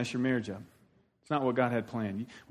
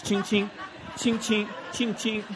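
high, quick mock shutter noises; kid imitating camera clicks; 6 semitones higher, slower than usual. Eight-year-old teasing parents hugging in kitchen, mock camera clicks. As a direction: chirp it like a toy camera. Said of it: "pretending he's taking pictures".

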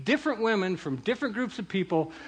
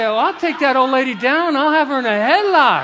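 loud, higher voice of an eager scrapper; mock fighting bravado; 5 semitones higher, 10 dB louder than usual. Imitating saints ready to take on protesters, loud mock bravado. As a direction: play up the readiness to tackle someone.

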